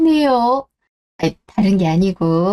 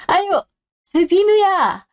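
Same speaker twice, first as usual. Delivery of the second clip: higher-pitched, fond cry of recognition; delighted greeting; 7 semitones higher than usual. Acted. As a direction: greet a long-unseen grandson with delight. Grandmother greets long-unseen grandson.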